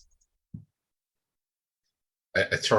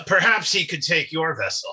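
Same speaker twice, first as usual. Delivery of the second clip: higher, lightly acted commodore; commodore's suggestion; 8 semitones higher than usual. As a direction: offer a measured suggestion to the princess. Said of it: "will just kinda nod and say".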